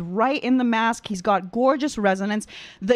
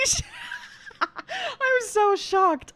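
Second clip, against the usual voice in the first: slow, higher, laughing through the words; shocked laughter; 13 semitones higher, 3 dB quieter, much slower than usual. Real, not acted. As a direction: laugh it out, overwhelmed.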